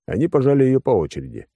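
plain reading voice

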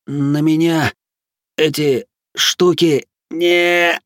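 high, slow, strained wheeze; hoarse croak. A narrator's reading. Captive resists the hypnotic probing, croaking with effort. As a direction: struggle it out haltingly, croaking. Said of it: "прохрипел Кнут".

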